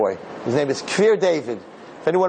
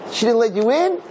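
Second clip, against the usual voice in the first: "She didn't let you in?" pitched high and louder; retold shock, high and loud; shocked question; 7 semitones higher, 4 dB louder than usual. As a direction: act out disbelief at the news. Retold surprise at failed trick.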